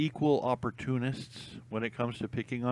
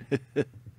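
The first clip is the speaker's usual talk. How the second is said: real, breathy run of chuckles; helpless chuckle; 3 semitones higher than usual. Real, not acted. Fan naming a favorite comedy show, helpless chuckle. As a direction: chuckle as if unable to help it. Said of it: "can't even say it without laughing".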